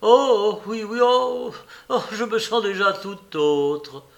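high, loud burst of delight; elated. A narrator's reading. Patient assuring his visitor he already feels better, elated. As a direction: gush with joyful relief.

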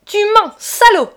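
very high, very loud outraged scream; exasperated shriek of fury; furious shout. A narrator's reading. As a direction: scream it right in his face. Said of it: "lui cria dans la figure".

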